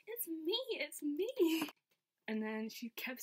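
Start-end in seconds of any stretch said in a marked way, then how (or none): 0.1-1.7 high, rising squeal of delight; excited squeal. Retold or imitated. Fan retelling how she answered her idol, excited squeal. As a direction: squeal it, pitch leaping up.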